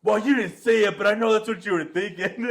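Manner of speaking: high, very loud imitation of a wounded mother; mom's aggrieved shouting. Mom accusing her boys of thinking it, aggrieved mock shout.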